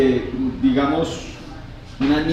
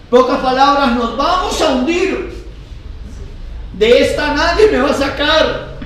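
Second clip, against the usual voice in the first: raised, forceful sermon voice; preaching; 9 semitones higher, 3 dB louder than usual. Real, not acted. Pastor telling the congregation of the boat in the storm, preaching. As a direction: preach it out loud and high to the whole room.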